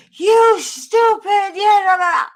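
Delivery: high, loud, slow mock scolding; scolding the cat. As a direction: yell at the cat in a put-on exasperated voice. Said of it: "and I'm like".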